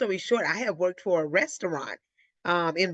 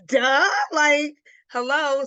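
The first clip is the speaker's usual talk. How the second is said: higher and louder, a cry of disbelief; exasperated duh; 9 semitones higher, 6 dB louder, much slower than usual. Retold or imitated. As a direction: spell out the obvious, exasperated. Mocking clueless boyfriend.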